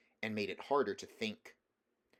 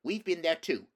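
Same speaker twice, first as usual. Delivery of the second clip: loud, high angry snap; snapping; 8 semitones higher, 9 dB louder than usual. A narrator's reading. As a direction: snap back at her. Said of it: "snapped Jill".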